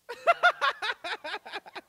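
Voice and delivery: loud, high cackling laugh; high-pitched laugh. Real, not acted. Preacher after his authenticious punchline, loud high laughing. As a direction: burst into a rapid high giggle.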